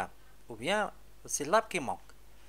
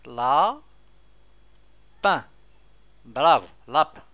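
loud teacher praise; 8 dB louder than usual. Shown as a demonstration. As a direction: call out the answer loudly. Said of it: "loud praise".

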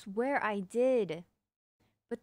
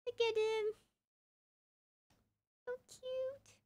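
high, hushed doting voice; showing the dog to chat, high soft coo; cooing pet voice; 6 dB quieter than usual. Real, not acted. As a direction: gush softly over the puppy. Cooing over the dog on cam.

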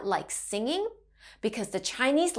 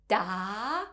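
DA gliding upward; rising tone; 3 semitones lower, 5 dB quieter than usual. Shown as a demonstration. Teacher showing Mandarin learners the second tone, rising. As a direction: start DA low and slide it up like a question. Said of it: "has a rising tone".

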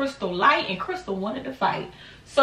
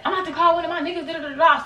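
fast mocking; 7 semitones higher, faster than usual. Quoting Crystal mockingly.

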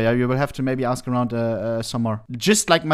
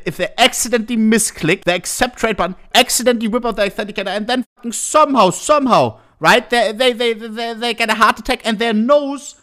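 higher and racing, mock-dramatic; exaggerated rant; 6 semitones higher than usual. Real, not acted. Streamer mocking spam traders with a higher, racing, mock-dramatic rant. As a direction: spin the absurd accident like a wild tale. Mock scenario of accidental accept.

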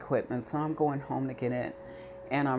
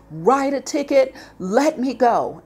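much higher, louder, slowing on let me go; retold furious shout; 10 semitones higher, 9 dB louder, slower than usual. To the officer, demanding he finish, shouting in anger. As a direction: yell it at him, fed up.

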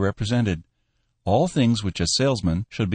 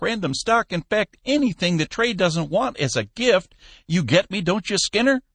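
put-on high, eager old businessman voice; a sly old lumber boss, egging on; old Cappy; 11 semitones higher than usual. A narrator's reading. Cappy urging his manager Skinner, high eager old man.

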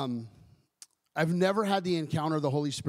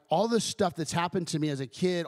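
ordinary delivery

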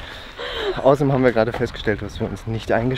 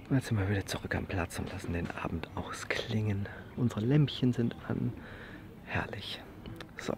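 whispering; 10 dB quieter than usual. Real, not acted. Quiet evening at the campsite.

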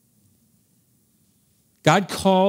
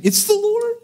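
excited. Retold or imitated. Disciple recognizing Jesus on the shore, excited cry. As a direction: blurt it out overjoyed. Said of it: "With excitement".

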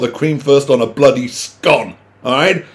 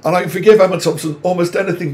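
usual speaking voice

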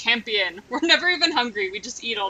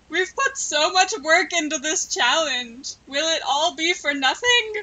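hammed-up mock tragic lament; melodrama; 3 semitones higher than usual. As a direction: play it like a soap opera cliffhanger. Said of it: "I have to ramp the melodrama".

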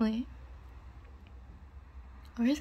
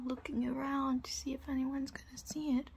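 whispering; 3 semitones higher, 6 dB quieter than usual. Acted.

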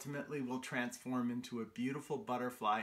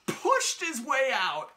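dramatic push; 7 semitones higher, 7 dB louder than usual. A narrator's reading. Caterpillar bursts out of cocoon.

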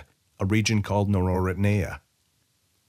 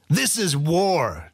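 slow, higher bellow of a general; 9 semitones higher, 3 dB louder, much slower than usual. A narrator's reading. General shouting down Weyra, slow higher bellow. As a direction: roar it out like a warlord. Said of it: "Jorkington bellowed".

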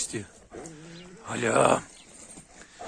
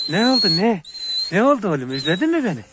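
high pet-talk coo; 6 semitones higher, 4 dB louder than usual. Real, not acted. Owner greeting his excited dog in high cooing baby talk.

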